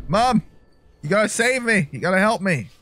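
high, loud play-acted cry for help; mock pleading. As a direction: call out to mom as a scared child in play. Calls to game mother for help.

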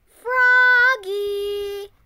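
calling out across the house; sing-song shouted call; loud call. Acted. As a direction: call his name loud and high, half sung. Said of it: "called his father".

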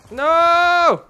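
long loud high "No!"; shout. Real, not acted. Gamer losing his kill, long loud shout. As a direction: wail it in dismay.

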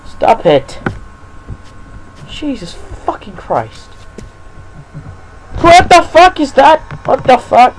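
panicked screaming, high and loud; terrified horror victim. Real, not acted. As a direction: shriek and plead in real terror. Chased by monster in game.

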